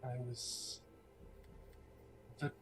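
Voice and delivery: soft, halting, measured recollection; hushed, mysterious woman; reminiscing villainess. Acted. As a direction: recall it quietly, pausing between phrases. Game master voices Lucretia.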